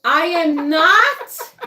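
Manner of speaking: loud, slow, high "I am not"; indignant high-pitched denial; loud protest. Real, not acted. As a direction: shut the idea down loudly. Protesting OnlyFans suggestion.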